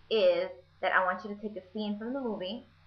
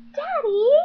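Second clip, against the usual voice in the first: much higher, much louder shriek; shrieking Daddy; 12 dB louder than usual. Retold or imitated. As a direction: scream it in shock. Nessie calls out to Edward.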